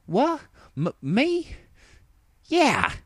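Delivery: squeaky, high stammer; flustered surprise. A narrator's reading. Applejack caught off guard by Rainbow, high and flustered. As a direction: caught out, deny too fast.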